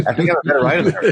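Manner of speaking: high, loud, laughing through it; cheeky laughing confession; laughing repeat. Real, not acted. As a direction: say it again giggling. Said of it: "It's good you can own that".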